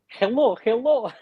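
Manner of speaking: put-on high Russian-accented greeting; imitated Russian accent. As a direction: call it out like a local trying English, in a Russian accent. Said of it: "that's a good accent".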